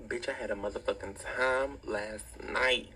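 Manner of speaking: usual speaking voice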